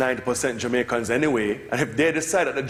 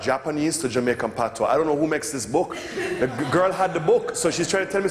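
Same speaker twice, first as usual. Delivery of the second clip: ordinary talk, nothing marked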